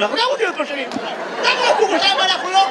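lightly voiced high little tadpoles; tadpole voices. Comic playing tadpoles who want a part, high playful voice. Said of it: "תפקיד בתור ראשנים".

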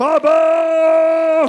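long drawn-out call of dad, loud; stretched loud cry of a toddler; child's shout. Acted. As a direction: bellow it like a small sheriff. Toddler calling dad like sheriff.

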